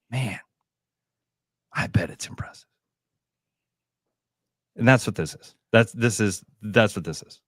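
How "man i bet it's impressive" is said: hushed flattering whisper. Retold or imitated. Makers flatter the man in a whisper. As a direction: breathe it like a secret compliment. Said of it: "they just keep telling him".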